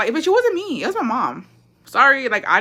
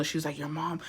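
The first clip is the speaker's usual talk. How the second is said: near whisper; 2 semitones lower, 13 dB quieter than usual. Retold or imitated. Whispered gossip.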